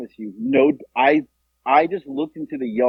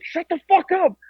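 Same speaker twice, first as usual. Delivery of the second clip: high-pitched mock exasperation; tense, acted-out command; urgent retold shushing. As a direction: imitate a friend desperately silencing someone. Friend silencing her before dad.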